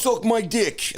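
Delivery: high mock Boston tough guy; hammed-up Boston jock voice; Boston accent. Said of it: "I can't even do a Boston accent".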